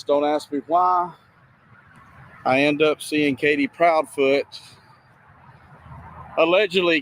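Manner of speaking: ordinary talk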